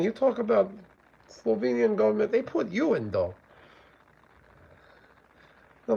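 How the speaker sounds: high-pitched mock Slovenian accent. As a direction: put on a rough guess at a Slovenian accent. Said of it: "I'm guessing that's what a Slovenian accent sounds like".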